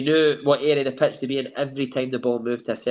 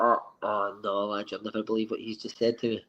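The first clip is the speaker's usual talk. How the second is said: dismayed teammate; 5 semitones lower, 8 dB quieter than usual. Retold or imitated. Matty telling the lads in the dressing room, dreading it. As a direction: drag it out like bad news. Matty retells manager's hug request.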